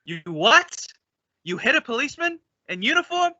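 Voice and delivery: can't believe what he just heard; shocked disbelief. Acted. Abbott aghast that Costello hit a cop.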